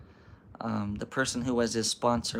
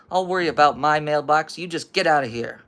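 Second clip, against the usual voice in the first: put-on loud, higher old-guy order; irritated dismissal; 4 semitones higher, 8 dB louder than usual. Old guy chasing boy from his mailbox, irritated and loud. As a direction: send the boy packing.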